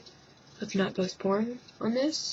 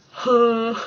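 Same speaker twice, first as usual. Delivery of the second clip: mock huh; 3 semitones higher, 6 dB louder than usual. Reacting to man getting hurt.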